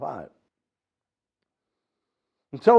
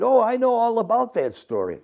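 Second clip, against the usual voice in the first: hobo voice; 10 semitones higher, 5 dB louder than usual. Acted.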